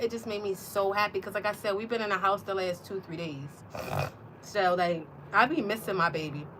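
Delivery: usual speaking voice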